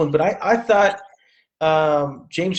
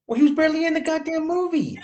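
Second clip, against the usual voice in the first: high-pitched indignant exclamation; exasperated complaint; 10 semitones higher than usual. Real, not acted. Friend griping about a film villain's screen time, exasperated. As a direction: blurt it out high, in comic outrage.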